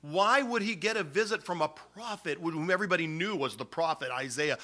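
ordinary talk

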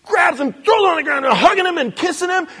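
loud, high, thrilled storytelling; excited retelling. Real, not acted. Preacher telling the father's welcome, loud and excited. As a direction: tell it like you can't contain the joy. Father's joyful embrace narrated.

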